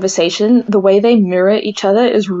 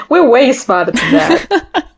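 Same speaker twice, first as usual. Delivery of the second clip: slow, higher, laughing boast; mock indignation; 5 semitones higher, slower than usual. Co-host jokes as insulted agent, mock indignant. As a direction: play offended while breaking into laughter. Joins in as offended Mossad agents.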